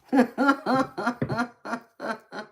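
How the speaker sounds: loud high burst of laughter; real laughing fit; big laugh. Real, not acted. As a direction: crack up at the chat. Streamer laughing at chat joke.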